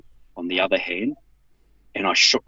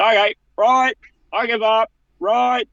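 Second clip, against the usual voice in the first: high, loud, desperate giving up; high surrender voice; 14 semitones higher, 6 dB louder than usual. Retold or imitated. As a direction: squeak out a frantic surrender. Retold surrender to police.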